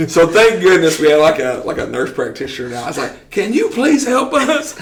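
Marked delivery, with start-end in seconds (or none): 3.3-4.8 pleading. Retold or imitated. Begging nurse for help.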